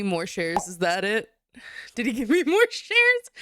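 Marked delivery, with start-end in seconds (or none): 1.9-3.3 loud, high question broken by a laugh; incredulous question. Real, not acted. Streamer questioning chat about boyfriend's shares, loud laughing incredulity. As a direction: burst out in laughing disbelief, voice climbing.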